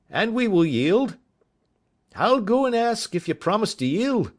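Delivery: high, loud call; shouted reply. A narrator's reading. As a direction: shout the bargain across. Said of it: "says Robinson".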